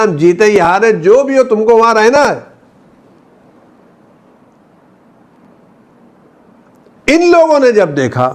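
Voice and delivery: very high, driving sermon cry; impassioned preaching. Real, not acted. Preacher recalling the Prophet's order to hold the hill, fervent. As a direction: let the pitch soar as in a fervent sermon.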